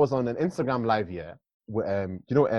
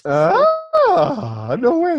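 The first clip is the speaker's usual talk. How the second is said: high, loud astonished outburst; shocked disbelief; 8 dB louder, much slower than usual. Real, not acted. Friend hearing she already found him, loud shocked disbelief. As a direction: react in shrieky, high-pitched disbelief. Reacting to friend's surprise news.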